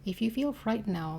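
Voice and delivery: understated ghostly threat, loud and lifted; unseen entity. A narrator's reading. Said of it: "the unseen entity seemed to tell her".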